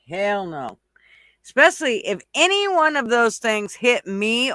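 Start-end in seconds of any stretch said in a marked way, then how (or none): none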